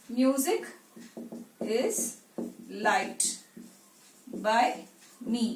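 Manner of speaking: long pauses between words; word-by-word dictation. Shown as a demonstration. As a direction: spell the sentence out piece by piece, slowly. Builds the passive sentence word by word.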